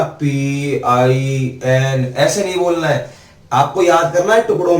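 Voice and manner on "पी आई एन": पी आई एन dragged out low and slow; mock the bad rote spelling पी आई एन; wrong way, flat spelling. Shown as a demonstration. Teacher showing students the wrong way, slow and low.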